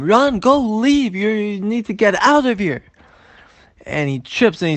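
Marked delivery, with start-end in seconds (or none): 0.0-2.8 high-pitched, loud shouted orders; screaming. Acted. The Baal Shem Tov chasing Shmulek out, screaming. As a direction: keep yelling at him to clear out. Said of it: "keeps screaming at him".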